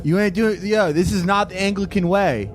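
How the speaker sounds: normal talking voice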